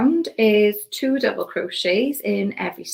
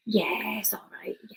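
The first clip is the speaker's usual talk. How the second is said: whispering; 7 dB quieter than usual. Real, not acted. Quiet aside while crocheting.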